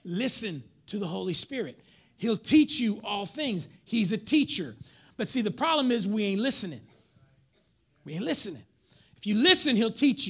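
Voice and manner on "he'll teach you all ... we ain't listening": ordinary talk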